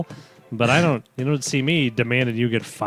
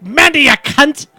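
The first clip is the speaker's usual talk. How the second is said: high, slow shouted mock yell; 13 semitones higher, 9 dB louder, slower than usual. Retelling a local's greeting, hammed-up yell. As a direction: belt out the quote high and slow. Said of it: "it's not like".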